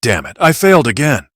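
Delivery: high, loud angry curse; frustrated outburst. Acted. Rescuer hears Terrence survived the shot, angry loud curse. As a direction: spit it out, furious at himself.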